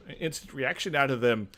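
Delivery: usual speaking voice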